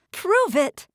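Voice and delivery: high loud scream. A narrator's reading. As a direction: scream the challenge. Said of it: "I screamed".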